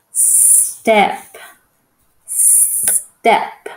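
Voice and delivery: "Step" with a drawn-out s; sounded-out word. Shown as a demonstration. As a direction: hold a long hissed s in "Step", then land on the rest. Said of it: "just listen to the sound".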